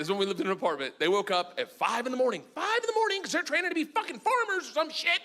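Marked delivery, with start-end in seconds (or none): 2.5-5.2 exasperated rant. Real, not acted. Exasperated at early waking.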